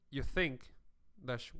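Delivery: ordinary delivery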